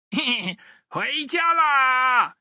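slow, high gloating call; drawn-out pleased whoop; smug cheer. Acted. Thief rolling out the gate, slow smug cheer. Woodcutter escaping with eggs.

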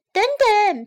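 high-pitched, loud chick shout. A narrator's reading. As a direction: cry out as a little chick. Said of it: "皮普叫道".

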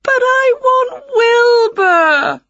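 loud, slow, high-pitched wail. A narrator's reading. Winnie wants her cat back, loud high wail. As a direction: bawl it like a distraught child. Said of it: "wailed Winnie".

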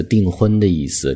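ordinary talk

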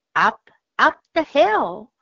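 ordinary talk

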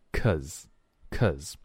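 "cause" shortened to "cuz"; reduced form. Shown as a demonstration. Teacher showing the further contraction, reduced form. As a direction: say "cause" with a short weak vowel. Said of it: "然后又缩成了".

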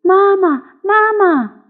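put-on high childlike call for mama; tadpoles calling. Tadpoles hail the goldfish as mother, high calling.

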